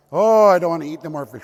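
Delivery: loud, fed-up groan of complaint; children sick of fish, loud complaint. Acted.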